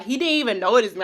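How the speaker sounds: normal talking voice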